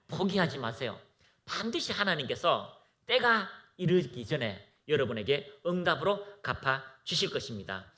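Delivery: normal talking voice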